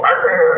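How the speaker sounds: imitated dog growl. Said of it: "and then the dog go".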